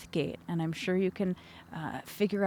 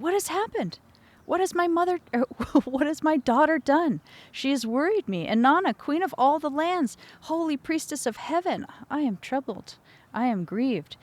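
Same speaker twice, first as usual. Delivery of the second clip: much higher, troubled plea of a worried god; worried father; 7 semitones higher than usual. Acted. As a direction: voice him high and fretting over his daughter. Father Enki's worried speech.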